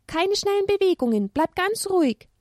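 high, careful warning; high childlike caution. Acted. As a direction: hush them to stay still. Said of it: "mahnte Elia".

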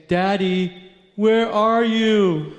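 put-on high, slow, drawn-out child's call; little son calling. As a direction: imitate a small boy calling out for daddy. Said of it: "And he was saying".

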